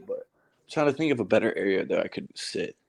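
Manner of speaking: echoey basement room sound; talking in a hollow basement. Real, not acted. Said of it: "I'm in the basement right now".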